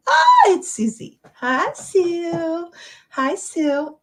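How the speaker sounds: high delighted squeal of recognition; excited greeting. Real, not acted. Host spots a friend joining, excited high greeting.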